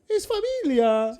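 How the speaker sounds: slow warm mock Italian; Italian mama embracing the fans; Italian accent. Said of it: "that Italian accent".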